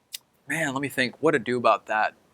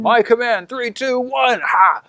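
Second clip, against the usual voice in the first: high, loud drill sergeant bark; military voice; 10 semitones higher, 6 dB louder than usual. Acted. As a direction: bellow orders at recruits. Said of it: "out bellows this military voice".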